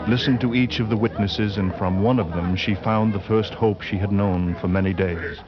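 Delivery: steady, measured storyteller voice; story narration. A narrator's reading. Narrator telling of Ellen's trip to the inquest, measured narration. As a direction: carry the listener through the plot calmly.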